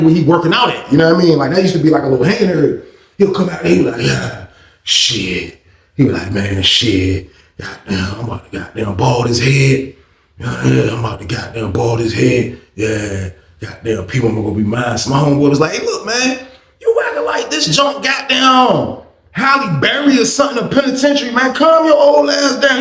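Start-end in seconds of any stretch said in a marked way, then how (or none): 4.0-15.0 put-on low-pitched old convict voice; lustful old inmate. Plays Old School lusting, muttering. 15.7-22.8 high-pitched acted telling-off; exasperated scolding. Homeboy tells Old School off.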